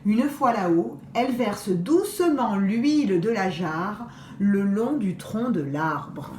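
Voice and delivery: ordinary delivery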